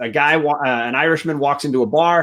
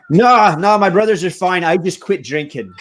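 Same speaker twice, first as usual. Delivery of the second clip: louder and higher, a quick correction; punchline exclamation; 6 semitones higher, 4 dB louder than usual. Acted. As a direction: fire off the punchline with a grin. Punchline, Irishman corrects the bartender.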